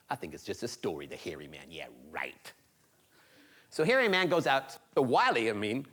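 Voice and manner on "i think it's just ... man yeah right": low, soft, dismissive muttering; scoffing disbelief. Acted. Boy doubting the hairy man exists, scoffing. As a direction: shrug off the scary tale under your breath. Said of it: "he started thinking".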